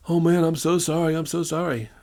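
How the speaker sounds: mock a cowed bully backing off; imitating an apology.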